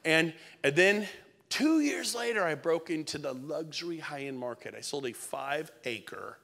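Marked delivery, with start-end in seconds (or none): none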